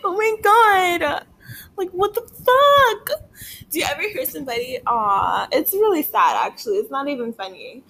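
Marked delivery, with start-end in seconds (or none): 0.0-3.3 slow, very high mock wail; hammed-up sobbing whine; fake cry voice. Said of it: "thought that was my real cry voice".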